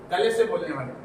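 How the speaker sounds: ordinary talk, nothing marked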